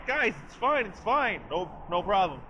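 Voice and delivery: high-pitched imitated reassurance; mock breezy reassurance. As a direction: call back to the group that all is well. Acting Xavier reassuring his friends.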